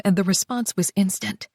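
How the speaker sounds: plain reading voice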